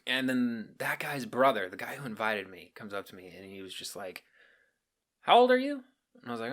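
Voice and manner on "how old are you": squeaky quoted question; high mock coworker voice. Coworker quizzing a teen at the party, high mock voice. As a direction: pitch it way up as the nosy coworker.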